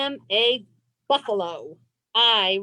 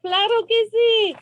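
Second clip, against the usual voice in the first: excited; 7 semitones higher, 4 dB louder, slower than usual. Acted. Cheering answer with kids.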